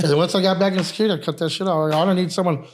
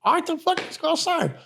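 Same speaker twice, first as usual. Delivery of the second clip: exasperated giving in; 11 semitones higher, 5 dB quieter than usual. Retold or imitated. Exasperated give-in, pitch way up.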